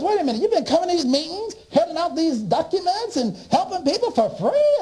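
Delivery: high, fast astonished question; astonished boss. Retold or imitated. The director learns he helped for free, astonished. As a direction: rush it out in disbelief.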